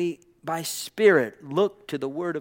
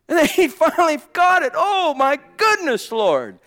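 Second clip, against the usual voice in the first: high, loud exultant outburst; fervent preaching cry; 12 semitones higher, 8 dB louder than usual. Acted. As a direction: cry it out at full pulpit pitch, far above your talk. Preacher crying out over Paul's breakthrough.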